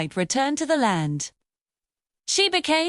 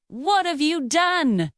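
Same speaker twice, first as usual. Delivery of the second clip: understated despair, slow and higher; despairing cry; 5 semitones higher, slower than usual. A narrator's reading. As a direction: narrate a restrained, drawn-out cry of despair. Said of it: "he cried in despair".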